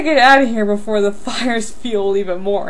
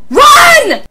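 very high, loud cries on the run; panicked shouting; 10 dB louder than usual. Acted. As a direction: scream it as you bolt. Fleeing from someone with a gun.

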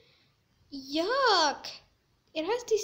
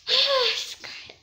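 exasperated; 9 semitones higher, 6 dB louder than usual. Real, not acted. Annoyed at pushing player.

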